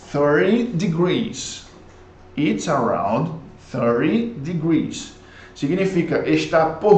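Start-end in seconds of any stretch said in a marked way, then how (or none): 0.1-1.7 slow model. Shown as a demonstration. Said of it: "model sentence". 2.4-5.1 slow repeat. Shown as a demonstration. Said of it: "model sentence repeated".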